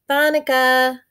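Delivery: put-on high, loud little-boy voice; a small boy tugging at his babysitter; little boy calling. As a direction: call out like an eager small child. Little brother calls the babysitter.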